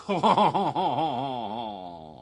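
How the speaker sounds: long comic laugh sliding down; hammed-up laugh. Performer capping his silly song, long mock laugh. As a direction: draw out a theatrical chortle. Laugh after the song's finale.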